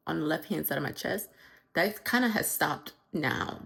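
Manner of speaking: ordinary talk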